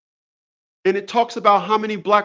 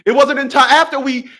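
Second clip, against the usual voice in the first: much higher and louder, rising indignation; heated rant; 9 semitones higher, 3 dB louder than usual. Acted. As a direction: ramp up into an outraged comic rant. Building comic rant, loud high.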